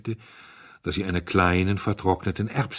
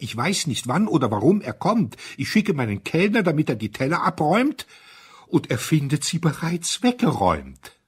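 much higher and louder, near panic; distressed, rising panic; 9 semitones higher, 6 dB louder than usual. A narrator's reading. Flustered owner explaining the vanished plates in panic. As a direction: voice climbing with worry. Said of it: "rang gequält die Hände".

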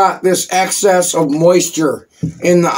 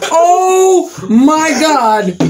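shocked; 8 semitones higher, much slower than usual. Real, not acted. Reacting to spicy heat.

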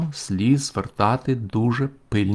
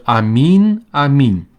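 loud, high, ringing amen; proclaimed amen; 4 semitones higher, 8 dB louder, slower than usual. Acted. Preacher declaring the Lord's words to listeners, loud and high. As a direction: call it out like a solemn proclamation.

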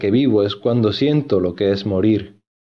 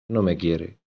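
"No me quiere" lower and much softer, the voice cracking; voice breaking; 4 semitones lower, 5 dB quieter than usual. A narrator's reading. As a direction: let it crumble on the repeat, near tears. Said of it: "se le quebró la voz".

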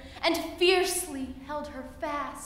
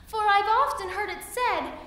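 high, loud little fly voice; buzzy housefly; 8 semitones higher, 9 dB louder than usual. Acted. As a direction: squeak it high and urgent. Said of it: "said the little fly".

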